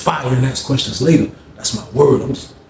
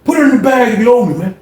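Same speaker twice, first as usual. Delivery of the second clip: aggressive demand; 8 semitones higher than usual. Retold or imitated. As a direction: bark it like a bully collecting. Fred shaking down inmates.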